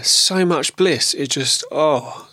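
slow, high, dreamy bliss; blissful. Real, not acted. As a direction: savour every word of the bliss. Recalling blissful breathing session.

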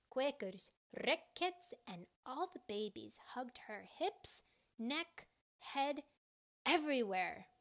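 ordinary delivery

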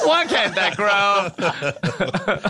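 slow stand-up punchline delivery; milk the line like a comic; comedian bit voice. Pretend. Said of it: "Is that your new Netflix special?".

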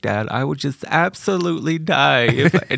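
lightly voiced dramatic teenage girl; a hint of a pleading teen girl. Begging dad about the concert, mild teen girl whine. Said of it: "Like, real teen girl".

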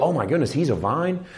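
soft, awed aside; imagined realization. Retold or imitated. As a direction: voice a quiet dawning discovery. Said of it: "they can go".

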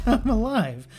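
slow, breathless laugh of relief; relieved laugh. Acted. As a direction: laugh it out, stunned to be alive. Relief after surviving.

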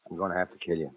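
low, soft death threat; quiet menace. Acted. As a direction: break it to her quietly, low in the voice. Killer announces his intent.

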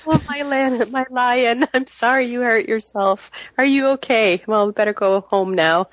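mock sweet wife. Fussing over her lion after he knocks himself out, mock sweet. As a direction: coo over him like a fake-sweet lover.

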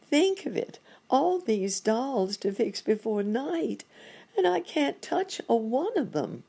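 overwhelmed character voice, pitched well up; fretting monkey doctor. Acted.

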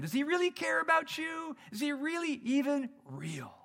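high, mocking voice of doubt; sneering devil's whisper-voice. The devil's voice planting doubt, high and mocking. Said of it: "that little voice just keeps coming in".

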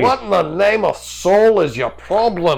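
hammed-up indignant outburst; acted scolding. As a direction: scold him in exasperation. [name] scolds the drunk who lit himself.

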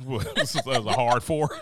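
slow, laughing through the words; amused and cracking up. Real, not acted. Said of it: "That four was a little hard".

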